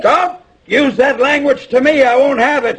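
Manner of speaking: very high, loud scolding; angry outburst. Acted. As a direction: shout him down in outrage. Father scolding grown son.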